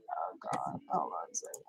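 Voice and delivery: hushed, voiceless whisper; very soft whisper under the breath; whispered aside. Real, not acted. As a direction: whisper it off to the side. Hushed aside while interrupted.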